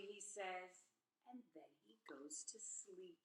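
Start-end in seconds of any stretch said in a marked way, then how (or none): 1.3-3.2 sleepy hushed whisper. A narrator's reading. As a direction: whisper it like a lullaby. Pig falls asleep.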